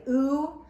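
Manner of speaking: teacher modelling the ooh sound. Shown as a demonstration. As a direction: model ooh with a lifted pitch. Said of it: "it can say".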